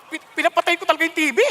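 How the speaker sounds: usual speaking voice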